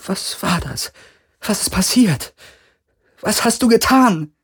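high, rising frightened questions; panicked. Acted. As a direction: ask in growing panic. Kirai terrified after the attack.

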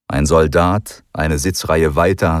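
plain reading voice

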